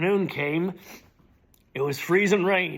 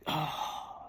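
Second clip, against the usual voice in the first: soft sigh; 8 dB quieter than usual. Acted. Dramatic poem recitation.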